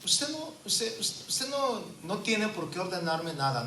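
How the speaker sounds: high-pitched defiant woman, a touch hammed-up; mock indignant retort. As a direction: voice the sister refusing the pastor. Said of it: "La mujer le dijo". (réplica indignada y aguda de la hermana)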